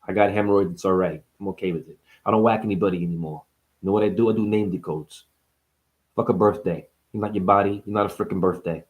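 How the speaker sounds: a hint of a Jersey mob guy; low Jersey gangster mumble; New Jersey mobster. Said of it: "speak like a frickin' guy from Jersey".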